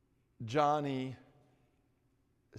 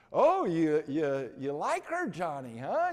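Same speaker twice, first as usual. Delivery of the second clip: teasing; 4 semitones higher, 9 dB louder than usual. Retold or imitated. Boys tease Johnny.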